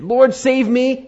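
high, loud, drawn-out plea; desperate cry for help. Retold or imitated. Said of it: "He cried out".

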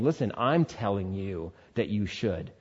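king's soft command. Acted. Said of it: "Nebuchadnezzar himself saying".

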